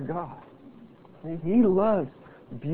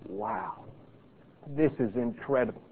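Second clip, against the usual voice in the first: low, soft murmur of wonder; acted awe, dropped low and soft; hushed awe; 6 semitones lower, 12 dB quieter than usual. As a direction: marvel quietly, almost to yourself. Models marvelling at creation.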